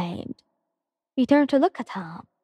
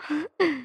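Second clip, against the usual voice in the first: soft laugh; 5 semitones higher, 5 dB quieter than usual. Real, not acted. Reader laughs after story.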